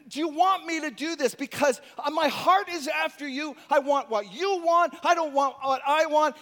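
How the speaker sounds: urgent prayer of David, nearly shouting; earnest pleading prayer. Acted. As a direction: pour your heart out loud. David praying urgently to God.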